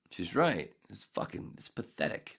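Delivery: ordinary talk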